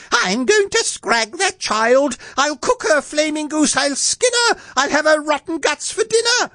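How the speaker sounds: very high-pitched, screeching rage; furious queen's yell. A narrator's reading. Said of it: "She yelled".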